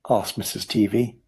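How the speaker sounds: usual speaking voice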